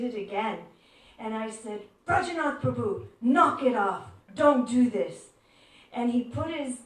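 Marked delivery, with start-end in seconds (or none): 2.1-5.3 loud mock telling-off; playful scolding. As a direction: call him out firmly but fondly. Scolding a teasing elder.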